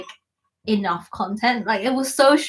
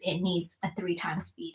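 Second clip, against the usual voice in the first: whispering; 4 semitones lower, 13 dB quieter than usual. Real, not acted. Joke about watching at triple speed.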